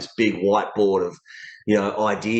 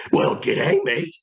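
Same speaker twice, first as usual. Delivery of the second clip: high-pitched cartoon dog with an Australian accent; Scooby-Doo in Australian accent; slower than usual. Shown as a demonstration. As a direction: try an Aussie-accented cartoon dog greeting, voice leaping up high. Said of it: "Scooby's voice in an Australian accent".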